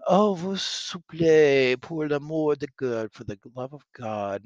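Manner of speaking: high, loud, drawn-out plea; pleading prisoner. Acted. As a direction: beg theatrically for mercy, stretching the words. Reads French soldier begging for mercy.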